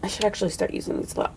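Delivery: fast unvoiced whisper to herself; whispered aside. Real, not acted. To herself about her unused highlights, a quick whisper. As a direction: breathe it like a private reminder. Muttered note-to-self aside.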